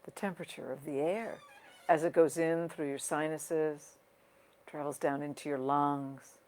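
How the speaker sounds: unhurried guiding voice with long pauses, softening toward the end; guided meditation. Real, not acted. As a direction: ease listeners into their breathing, leaving space between phrases. Leads a breathing meditation.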